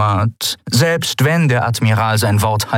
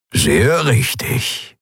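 slow, wise lizard; drawn-out reptile agreement; Nongdula's voice; 6 semitones higher, much slower than usual. Acted. Lizard Nongdula agreeing with the captain, slow. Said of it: "sagte Nongdula".

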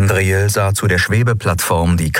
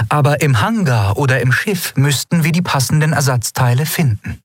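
hint of a worried engineer reporting damage; Aylan's voice; 7 semitones higher than usual. A narrator's reading. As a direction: read Aylan a bit higher, grave news.

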